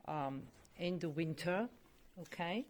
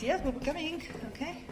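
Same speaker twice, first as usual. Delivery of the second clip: high, louder reply to the dog; pet talk; 10 semitones higher, 5 dB louder, faster than usual. Real, not acted. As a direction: answer the dog in a high sing-song. Answering the dog.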